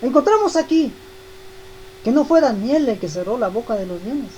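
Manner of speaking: usual speaking voice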